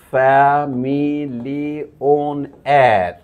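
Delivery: ফ্যামিলিয়নিয়ার drawn out very slowly, syllable by syllable; coined word demo. Shown as a demonstration. Lecturer unveiling the blended joke word, very slow. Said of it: "প্রথমটাকে এইভাবে লিখলো".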